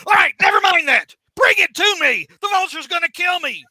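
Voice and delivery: high, loud mock panic; frantic yelling. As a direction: shout it like a desperate hero. Acted hero pleading for his life.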